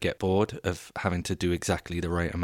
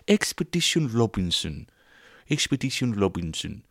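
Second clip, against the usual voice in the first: Swedish accent; 3 semitones higher than usual. Shown as a demonstration. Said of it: "Is that the Swedish accent?".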